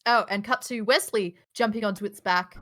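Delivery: usual speaking voice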